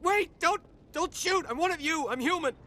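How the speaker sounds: high, loud cry for mercy; alarmed, urgent shouting; panicked plea. Acted. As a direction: beg them frantically to hold fire. Pleading not to be shot.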